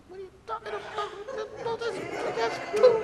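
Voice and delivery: mock outrage at washing poop; outraged whine. Preacher arguing with the Holy Spirit, high and outraged.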